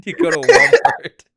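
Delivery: can barely get the words out for laughing. Real, not acted. Laughing through the retelling.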